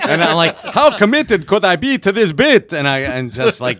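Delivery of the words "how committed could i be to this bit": high, loud vampire declamation; theatrical mock-vampire voice; Dracula. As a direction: proclaim it as a hammy vampire. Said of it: "talk like a vampire".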